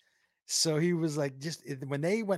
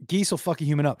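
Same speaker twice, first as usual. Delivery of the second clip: ordinary talk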